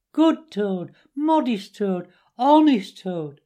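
mocking, high-pitched speechmaker; high squeaky weasel. A narrator's reading. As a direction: squeak out sarcastic praise for the crowd. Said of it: "began in a high, squeaky voice".

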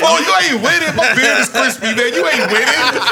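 loud, high, playful boasting; hyped-up bragging. Pretend. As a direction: crow it like a hype man, laughing. Hyped-up bragging as he takes over.

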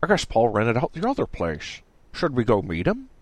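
lightly put-on voice for a sluggish friend; Bowman's character voice. A narrator's reading. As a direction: voice the friend, casually curious.

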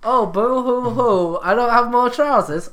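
slow, loud, high mock whine; hammed-up fake sobbing; mock crying. Mocking the teacher.

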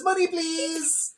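high, soft, drawn-out sing-song plea; mock a sweet plea, stretched out and high; begging corporation. Mimics corporation begging, echoing earlier joke.

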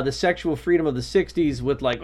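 usual speaking voice

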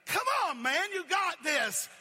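high, loud, slow call from the boat; encouraging shout. Pretend. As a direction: yell encouragement across the water. Urging drowning swimmer.

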